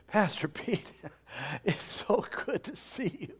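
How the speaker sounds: high, soft gushing greeter; lightly mock the fawning welcome; gushing church member. Congregants greeting returning pastor.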